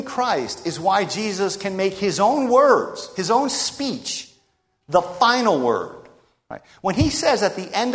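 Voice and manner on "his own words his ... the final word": slow emphatic. Acted. Emphasising Jesus' authority.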